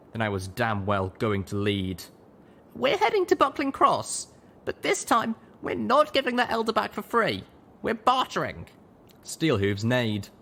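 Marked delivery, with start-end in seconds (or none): 2.8-8.7 high, loud, decisive leader; commanding young mare. A narrator's reading. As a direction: lay down the plan firmly. Littlepip leading, firm.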